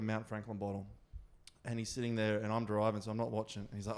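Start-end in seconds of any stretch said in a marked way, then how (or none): none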